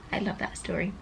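normal talking voice

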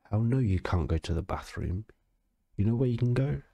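ordinary talk

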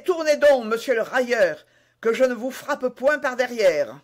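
furious cry, donc hit hard; shouted challenge. A narrator's reading. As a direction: shout him down from behind. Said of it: "en criant".

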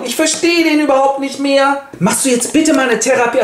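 pitched well up, pleading wife; a fed-up wife begging him to get help; worried wife voice. Acted. Wife pleads with her gold-obsessed husband, high and fretful. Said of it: "seine Frau sagt auch".

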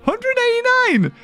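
very high incredulous exclaiming; astonished. Real, not acted. Player discovers huge meat stock, high astonishment. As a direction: squeak it out in disbelief.

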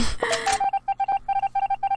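squeaky burst of laughter; high squeal laugh. Real, not acted. Gamer laughing at pregnant-looking bots. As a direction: let out a high giggle.

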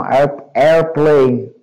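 airplane drawn out, AIR-plane; slow model of airplane; airplane, slowly. Shown as a demonstration. Teacher reviews pronunciation of airplane, slow and deliberate. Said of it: "ver a pronúncia dessas palavras".

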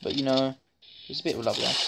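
ordinary talk

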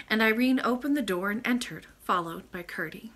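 usual speaking voice

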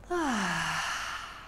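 long audible sigh of relief; cleansing sigh. Shown as a demonstration. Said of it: "after next cleansing breath".

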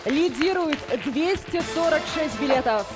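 ordinary talk

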